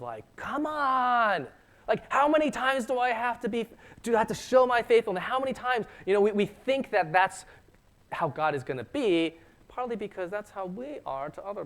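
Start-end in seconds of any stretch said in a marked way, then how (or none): 0.4-5.9 exasperated, pitch high throughout, voicing an impatient God. Preacher voicing an imagined impatient God to the congregation, exasperated and high. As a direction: come on, how many times, fed up. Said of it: "have the attitude of".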